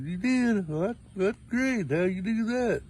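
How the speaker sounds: high-pitched surprised delight; old man pretending to be wowed by the hormone news; mock amazement. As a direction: act impressed, voice jumping up.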